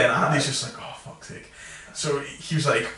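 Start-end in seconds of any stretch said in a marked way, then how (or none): 0.8-1.5 very quiet, voiceless mock curse; imitated low exasperated aside; muttered exasperation. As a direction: curse wearily under your breath. Retold exasperated aside from Andy.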